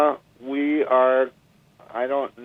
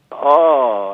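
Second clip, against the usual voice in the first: stunned oh; 6 semitones higher, 9 dB louder than usual. Retold or imitated. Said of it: "And I just went".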